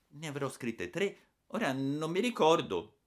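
put-on high, sheepish child voice; timid excuse. A narrator's reading. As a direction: offer a feeble excuse meekly. Frightened boy makes a lame excuse.

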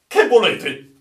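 high, loud startled demand; sudden alarmed shout; startled cry. Acted. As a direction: blurt it out sharply, high and loud. Startled sailor confronts officer, acted.

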